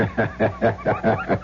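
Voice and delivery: mocking laugh. Blackmailer mocks her attempt.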